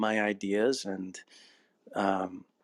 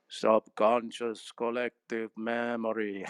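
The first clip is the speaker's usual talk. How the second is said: put-on slow, heavily accented old professor; Frankenstein; slower than usual. Teacher pronouncing to his amazed students, slow Frankenstein voice. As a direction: draw each word out like a movie monster scientist. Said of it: "like he's Frankenstein or something".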